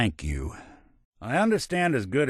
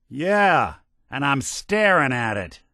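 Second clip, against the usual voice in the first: irritated retort; 5 semitones higher, 4 dB louder than usual. Acted.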